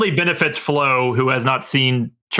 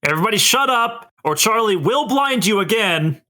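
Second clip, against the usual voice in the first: higher, snapping command; angry threat; 8 semitones higher than usual. Acted. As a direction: bark at the crowd. Charlie threatens the crowd.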